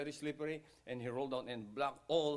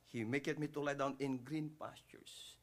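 ordinary talk

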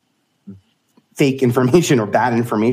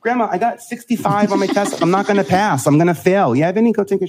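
put-on high, loud eager kid then grandma's reply; boy telling grandma his test score, high and excited; 10 semitones higher, 3 dB louder than usual. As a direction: play the proud child and the dismissive grandma. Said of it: "But I would come home".

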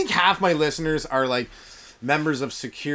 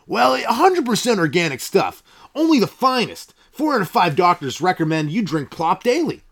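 pushy pitchman; 3 semitones higher, 4 dB louder than usual. Acted. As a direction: sell it like a cheesy TV ad guy. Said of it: "I got a commercial coming up".

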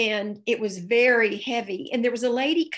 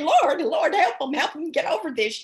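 1 pleading prayer; 5 semitones higher, 4 dB louder than usual. Retold or imitated. Friend retells praying mid-fight.

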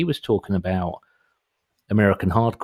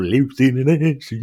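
higher, hammed-up Cockney with laughter; mock Cockney accent; 5 semitones higher, 5 dB louder than usual. Mocking a pop song's refrain, exaggerated Cockney accent. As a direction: ham up a bad stage Cockney.

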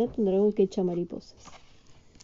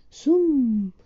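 sound word; 5 semitones higher, 6 dB louder than usual. A narrator's reading. Sound effect in story.

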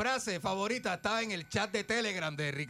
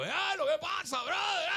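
ex-con greeting; 12 semitones higher, slower than usual. Retold or imitated. Retelling the ex-con's greeting at the bar, shouted high. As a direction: bellow it like the buddy just out of prison. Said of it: "me dijo".

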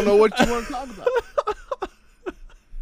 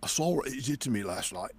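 lightly voiced low menacing threat, much softer; a hint of a quiet movie-villain threat; softly menacing Mo impression, trailing into a laugh; 7 semitones lower, 5 dB quieter than usual. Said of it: "Mo's gonna say".

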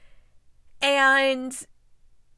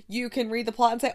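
ordinary talk, nothing marked

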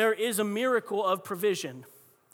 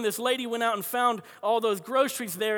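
ordinary delivery

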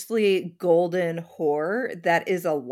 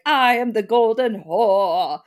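mock proclamation; 5 dB louder than usual. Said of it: "paint themselves in gold and be like".

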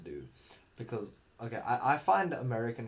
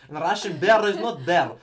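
loud, higher, hammed-up Russian accent; 6 semitones higher, 13 dB louder than usual. Shown as a demonstration. Showing co-host his satisfying Russian insults, Russian accent. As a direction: boom out mock threats like a Russian. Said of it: "I do a Russian accent pretty well".

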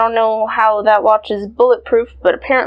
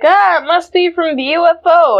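surprised; 7 semitones higher, 4 dB louder than usual. Retold or imitated. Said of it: "Jimmy's like".

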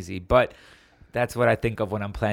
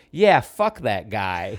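mock defiance; 5 dB louder, much slower than usual. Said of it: "I look right at him and go".

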